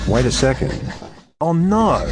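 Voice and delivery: sudden fearful oh no; alarmed. Acted. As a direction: realize the danger. Reporter senses danger.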